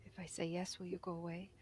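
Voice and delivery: small frightened whisper from under rubble; buried underground. Acted. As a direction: whisper it, scared and hidden. Same frightened buried figure.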